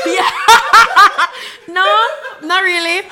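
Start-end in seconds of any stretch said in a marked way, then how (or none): 0.3-1.6 loud, high laughter bursting out. Real, not acted. Laughing hard at her friend's story. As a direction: crack up loud at the joke.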